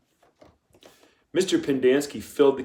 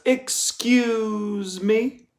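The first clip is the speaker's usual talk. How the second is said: higher, very slow, drawn-out "excuse me"; the warden cutting him off with a slow, drawn-out "excuse me"; icy warden; 12 semitones higher, 3 dB louder, much slower than usual. A narrator's reading. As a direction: stretch each word out, in cold control. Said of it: "Her voice was soft".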